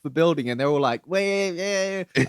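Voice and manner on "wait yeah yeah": higher-pitched drawn-out mindless wail; wordless-sounding zombie-like whine; mock crowd wailing. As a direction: imitate a hypnotized mob's noise. Imitating hypnotized crowd causing trouble.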